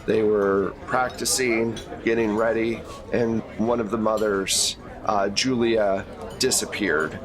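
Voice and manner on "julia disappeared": ordinary delivery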